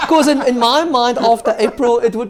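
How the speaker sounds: laughing. Real, not acted. Laughing about swiping credit card.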